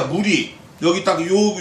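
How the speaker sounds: ordinary delivery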